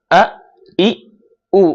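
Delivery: আ, ই, উ each cut short in the throat; glottal jolts. Shown as a demonstration. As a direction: push and abruptly stop each of আ, ই, উ. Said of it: "ধাক্কা দিতে হবে". (আ, ই, উ আলাদা করে ধাক্কা দিয়ে)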